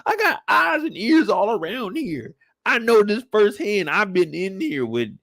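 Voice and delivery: captain boasting he sees everything; angry captain. Retold or imitated. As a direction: lay down the law to Burke. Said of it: "He said".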